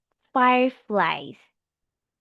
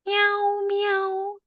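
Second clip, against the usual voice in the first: high, drawn-out playful meows; cat meow; 11 semitones higher than usual. Pretend. Teacher giving a child an animal clue, cat meow. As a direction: mimic a kitten on a high held note. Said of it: "What animal is".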